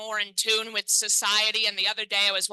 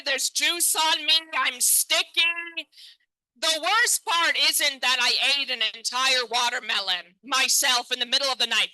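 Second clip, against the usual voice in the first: usual speaking voice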